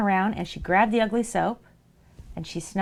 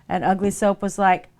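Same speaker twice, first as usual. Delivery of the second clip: ordinary talk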